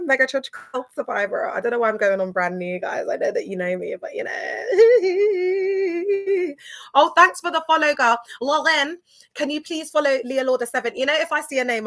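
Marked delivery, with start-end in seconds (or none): 4.7-6.9 high-pitched burst of laughter. Real, not acted. Host laughing at herself for introducing herself again, high laugh. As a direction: laugh out at your own rambling.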